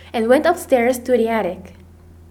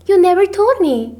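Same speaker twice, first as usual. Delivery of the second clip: higher, louder hurt protest; upset protest; 7 semitones higher, 5 dB louder than usual. A narrator's reading. Ermengarde learns Sarah goes hungry, upset. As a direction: protest to a friend who kept it secret.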